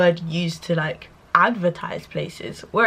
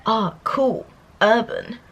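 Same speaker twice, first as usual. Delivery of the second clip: lightly voiced impressed classmate; mock approving voice; 3 semitones higher, slower than usual. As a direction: toss it off like a mildly impressed peer. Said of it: "she would get, like, words like".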